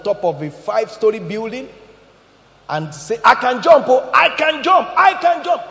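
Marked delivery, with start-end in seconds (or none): 3.2-5.7 shouted high, mocking a show-off; mock boasting shout. Preacher mocking a rooftop boaster, loud shout. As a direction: shout it like a braggart on a roof.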